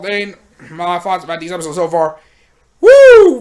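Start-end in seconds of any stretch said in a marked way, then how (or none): 2.8-3.4 loud, high cheer of hype; excited whoop. Real, not acted. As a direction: let out a big whoop of excitement. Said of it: "hyped exclamation".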